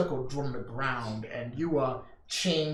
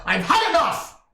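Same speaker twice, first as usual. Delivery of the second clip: high, loud furious yell; villain's shout; 11 semitones higher, 8 dB louder than usual. Acted. Captor screaming at the chained prisoner, furious shout. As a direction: bellow it at the prisoner in rage. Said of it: "he screams and he goes".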